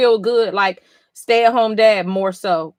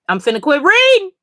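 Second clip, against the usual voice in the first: higher, louder, dramatic exasperation; exaggerated disbelief, pitched up high; exasperated; 7 semitones higher, 5 dB louder than usual. Real, not acted. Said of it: "I'm really about to quit reading".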